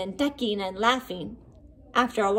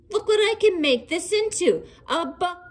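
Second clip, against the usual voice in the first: higher, eager show-off; proud monster; 8 semitones higher than usual. A narrator's reading. Hughley shows friends his snowball, eager. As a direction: show off your new trick.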